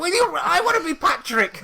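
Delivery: squeaky cartoon whine, way up high; SpongeBob voice. Telling the crowd how SpongeBob begged, in a high cartoon voice.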